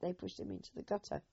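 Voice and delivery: usual speaking voice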